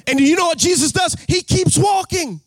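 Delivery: preacher's rising cry; rising preaching. Acted. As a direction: lean on every word like a preacher. Preacher builds to full force.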